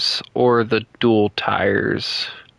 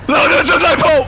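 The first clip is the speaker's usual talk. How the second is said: high yell; 6 dB louder than usual. Pretend. Yelling in character.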